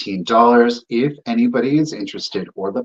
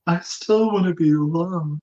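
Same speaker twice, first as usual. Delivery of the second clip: high doll voice; 7 semitones higher than usual. Pretend. S1 speaks as the doll.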